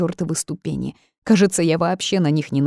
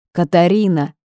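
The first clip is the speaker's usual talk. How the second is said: louder call of the name; urgent call; 6 dB louder than usual. A narrator's reading. Ghost calling the heroine as she fades, urgent loud call. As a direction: raise the voice to reach her.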